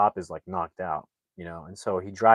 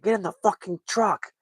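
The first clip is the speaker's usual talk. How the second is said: high, straining yell, even harsher; furious shouted command, cursing; furious cop yelling; 11 semitones higher than usual. Retold or imitated. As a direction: scream the order with the gun drawn. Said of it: "the cop gets mad".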